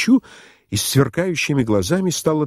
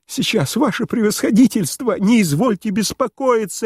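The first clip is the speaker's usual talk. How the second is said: high, eager reassurance; energetic cry; 10 semitones higher than usual. A narrator's reading. Host rushing to rescue His Excellency, energetic cry. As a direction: jump in brisk and high-pitched. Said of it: "энергически вскрикнул Псилдонимов".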